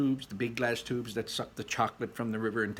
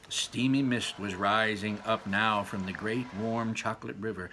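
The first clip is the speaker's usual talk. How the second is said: usual speaking voice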